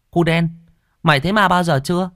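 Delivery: put-on boy up a guava tree asking his friend, eager and high; curious village kid. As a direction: ask it eagerly like a twelve-year-old. Kid asks friend about ghosts.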